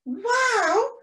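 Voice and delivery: high-pitched storybook wow; amazed penguin. Acted. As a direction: exclaim in high-voiced delight. Pilar reacts to the idea.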